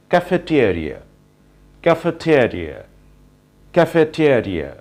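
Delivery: cafeteria with an f and "teer", said slowly; teacher modelling cafeteria with an f sound; right pronunciation. Shown as a demonstration. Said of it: "right pronunciation of this word".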